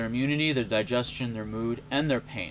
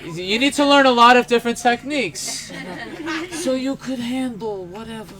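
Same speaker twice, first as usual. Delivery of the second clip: loud, much higher Sicilian mentor voice; Sicilian accent; 9 semitones higher, 6 dB louder than usual. Retold or imitated. Retelling the mentor's lesson in a loud Sicilian accent. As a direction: belt it out with a Brooklyn Sicilian twang. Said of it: "I can't do a Sicilian accent".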